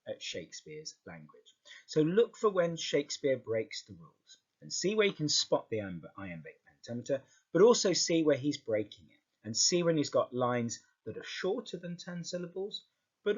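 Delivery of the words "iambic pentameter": ordinary talk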